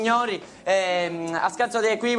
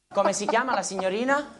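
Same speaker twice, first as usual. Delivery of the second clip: normal talking voice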